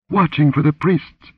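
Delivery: high-pitched, fearful reply; whimpering. A narrator's reading. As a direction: answer in a frightened whimper. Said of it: "she whimpered".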